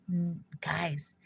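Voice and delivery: a quick unvoiced whisper; whispered aside. Real, not acted. Whispering an aside to the live viewers. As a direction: drop to a breathy hush for a beat.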